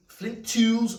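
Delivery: "flint tools" with a slight Scouse Liverpool twang on "tools"; "tools" drawn out in a light Scouse Liverpool lilt; Scouse accent slip. Real, not acted. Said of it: "It's the Scouse accent".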